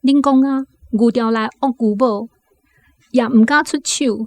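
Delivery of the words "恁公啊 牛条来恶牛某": normal talking voice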